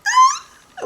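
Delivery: very high, loud overjoyed shriek; overwhelmed shriek. Retold or imitated. Fan shrieking on meeting her idol in the flesh. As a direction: let out a shriek of disbelief. Bursting into tears meeting Mark.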